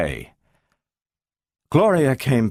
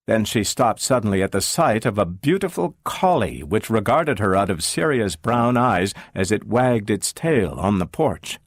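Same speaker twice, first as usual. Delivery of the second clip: ordinary delivery, nothing marked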